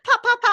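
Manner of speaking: high, loud pop pop pop; mock popping sounds. Girls at school popping champagne, high mock pops. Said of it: "They're just like".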